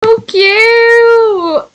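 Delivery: very high, loud squeal; high excited squeal; squealing so cute. Real, not acted. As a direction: squeal with delight. Gushing over cartoon koala.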